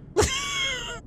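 high startled giggle; a yelp turning into a laugh; squeaky laugh. Real, not acted. Scared by coin trap.

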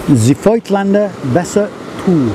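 mock German pronunciation, Voigtlander with F; slow, hammy German accent on the name. Shown as a demonstration. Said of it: "in my best or even worst German accent".

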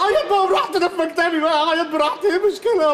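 mock crying. Employee sulking to his boss in his office, hammed-up crying. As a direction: blubber like a sulking scolded employee. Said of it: "بقول لك ما تعيطش".

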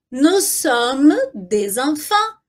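slow, drawn-out model phrase with linked "des enfants"; slow repeat-after-me. Shown as a demonstration. Teacher asking learners to repeat along, slow and measured. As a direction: stretch it out for learners to follow. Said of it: "Repete aí comigo".

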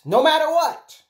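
"no matter what" high and loud; emphatic insistence. Real, not acted. Repeats it with far more force.

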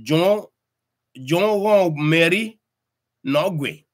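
slow, high lift on "won" in village speech; Mekong village speech. Shown as a demonstration. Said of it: "they say".

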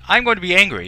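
playfully loud. Real, not acted. Streamer picks angry reply.

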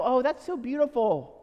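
put-on light, high gushing over children; mock gushing. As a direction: coo it like a charmed onlooker. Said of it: "we go".

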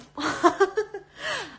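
loud high-pitched laughter. Real, not acted. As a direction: cackle out loud. Wild laugh at chaotic live. (높고 크게 웃는 웃음)

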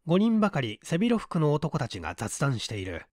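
ordinary delivery, nothing marked

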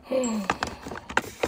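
sharp audible gasp; excited gasp. Real, not acted. Reactor thrilled by the products, excited gasp. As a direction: suck in a breath with excitement. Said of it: "That's how excited I'm getting".

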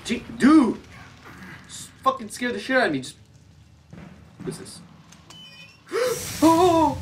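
high, loud panicked yelp; startled out of his skin; scared yelp. Real, not acted. Jump scare in game.

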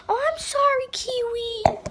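sudden high gasp, then a quick sorry; startled gasp. Real, not acted. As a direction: caught off guard by the bird. Startled by the bird moving.

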